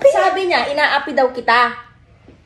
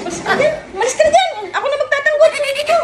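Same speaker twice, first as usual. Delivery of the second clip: higher, louder playful yelling, laughing; laughing shout; 13 semitones higher, 3 dB louder, faster than usual. Real, not acted. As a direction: push him aside with a playful yell. Shoving partner aside, laughing.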